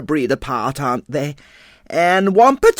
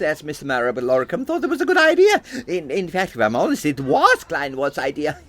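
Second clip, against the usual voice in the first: put-on chatty old-country theatrical landlady; 3 semitones higher, 3 dB quieter than usual. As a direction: reminisce proudly as an immigrant seaside landlady, voice leaping up on exclamations. Plays the immigrant landlady introduced beforehand.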